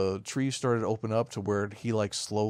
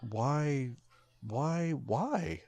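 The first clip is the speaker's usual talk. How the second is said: baffled disbelief; 3 semitones higher, much slower than usual. Retold or imitated. Mock reaction to dumb ending.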